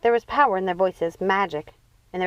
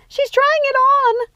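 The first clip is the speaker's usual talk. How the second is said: much higher, louder, slow drawn-out squeal; thrilled squeal; 7 dB louder, slower than usual. Acted. Sister squealing as Rapunzel tries on the crown. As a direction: squeal it with delight.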